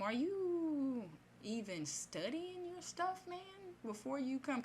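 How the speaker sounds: high, drawn-out sceptical question; mock-disbelieving grilling; incredulous challenge. Imagined challenge to the pastor.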